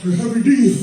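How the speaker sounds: mock Biggie flow; [name]. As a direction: rap it like the [name] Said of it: "impersonations of famous big people".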